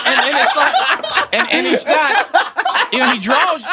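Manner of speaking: usual speaking voice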